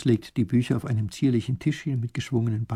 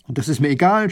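louder, cross; scolding; 3 semitones higher, 6 dB louder than usual. Acted. Grand duke scolding the professor, cross. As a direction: snap it back. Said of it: "schimpft der Großherzog".

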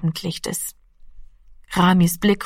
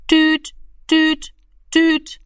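high, level dial-tone beeps; telephone tone imitation; 9 semitones higher, much slower than usual. A narrator's reading. The telephone receiver sounds for Rami, imitated dial tone. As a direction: imitate the phone's beeps on one high note. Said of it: "machte es".

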